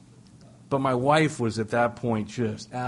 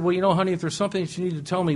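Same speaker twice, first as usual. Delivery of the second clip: higher, louder coaxing "honey"; 8 semitones higher, 3 dB louder, much faster than usual. Retold or imitated. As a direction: sweet-talk her to confess. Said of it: "I said".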